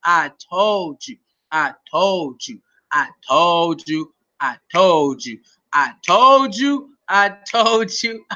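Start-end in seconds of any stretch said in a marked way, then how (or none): 0.0-5.4 slow, low, drawn-out TOLD; low I-told-you-so. Real, not acted. Gloating over a proven prediction. 5.7-6.9 slow, stretched TOLD swinging upward; rising I-told-you-so. Real, not acted. Gloating over a proven prediction.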